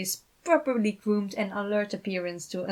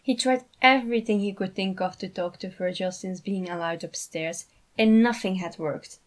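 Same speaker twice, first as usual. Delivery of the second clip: plain reading voice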